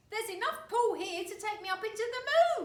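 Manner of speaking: high, loud wife voice; crying out as Mrs Twit. A narrator's reading. Wife crying out that the pull is strong. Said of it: "cried Missus Twit".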